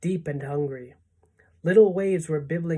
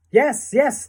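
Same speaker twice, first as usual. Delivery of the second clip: high, loud excited agreement; eager chorus; 8 semitones higher, 9 dB louder than usual. A narrator's reading. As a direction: call out eagerly as a crowd. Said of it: "they chorused".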